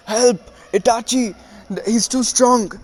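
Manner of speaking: high, loud desperate yelling; hammed-up panicked plea to a partner; panicked cry for help. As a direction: shout for rescue, losing the fight. Kisame begging Itachi for help.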